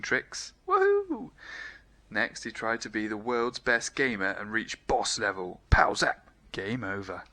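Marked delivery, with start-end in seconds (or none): 0.7-1.3 very high, loud whoop; skating kid whooping; woohoo. A narrator's reading. Skating tricks. 5.7-6.3 loud unvoiced pow zap; game sound effects. A narrator's reading.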